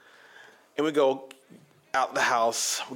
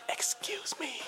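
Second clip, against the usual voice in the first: exaggerated breathy whisper of Excuse me; mock whisper; 11 dB quieter, slower than usual. Answering the guy who asked for quieter, mocking whisper. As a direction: whisper Excuse me sarcastically soft. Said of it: "Do you want me to say it quieter?".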